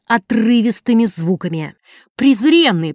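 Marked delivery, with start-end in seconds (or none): 2.2-2.9 higher, louder furious roar. A narrator's reading. Wife roaring at her husband in fury, loud roar. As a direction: roar the insult at him. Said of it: "проревела она наконец".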